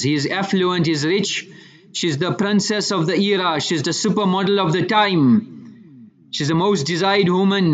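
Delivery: ordinary talk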